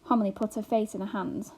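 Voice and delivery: plain reading voice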